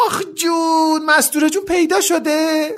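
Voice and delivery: very high, delighted squeal; piercing high-pitched excited scream; shrieking. A narrator's reading. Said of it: "یه جیغ بنفش کشید و گفت".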